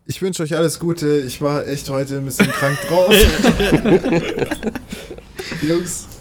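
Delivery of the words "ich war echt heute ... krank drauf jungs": laughing through the words; trying to talk but giggling; stifled giggles. Real, not acted. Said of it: "ich kann nicht aufhören zu lachen".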